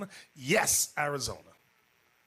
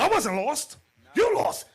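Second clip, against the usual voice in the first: high, loud comeback; cocky retort; 10 semitones higher, 6 dB louder than usual. Retold or imitated. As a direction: toss it back smugly. Intruder retorts.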